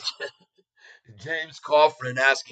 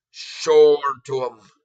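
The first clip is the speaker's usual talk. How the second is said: louder, slower second call; loud slow repeat; 7 dB louder, slower than usual. Shown as a demonstration. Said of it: "Show it to 'em".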